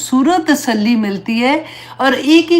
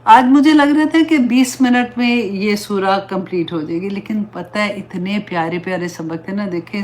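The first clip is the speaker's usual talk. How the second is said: ordinary delivery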